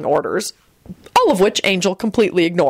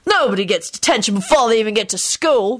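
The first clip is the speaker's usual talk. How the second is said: put-on higher, scornful boy; reply with disgust; sneering schoolboy dismissal; 4 semitones higher than usual. A narrator's reading. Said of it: "the boy replied disgustedly".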